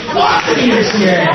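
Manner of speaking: loud shouted chant; shouting. Real, not acted. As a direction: yell it out to the crowd.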